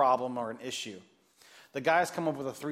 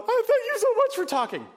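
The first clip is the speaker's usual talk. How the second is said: high, loud gushing thanks; tearful thanks; 6 dB louder than usual. Retold or imitated. Mom thanking youth pastor, high gushing gratitude. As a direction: pour out thanks through tears.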